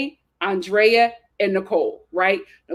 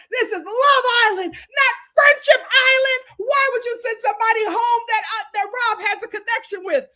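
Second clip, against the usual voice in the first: mock outraged yell; 8 semitones higher than usual. Aaron yelling at the girls, higher outraged quote. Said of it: "looks over at the girls and screams".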